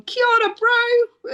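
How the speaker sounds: high, loud shout out the window; a bright hollered hello from a passing car; called greeting. Retold or imitated. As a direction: call it cheerfully to a stranger while driving past. Calling out car window to rider.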